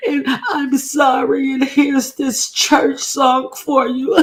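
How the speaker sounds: high, slow hammed-up wailing apology; overdone fake crying plea; mock teary apology. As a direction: fake a sobbing sorry, way up high. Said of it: "I did this old bitchy, uh, teary cry".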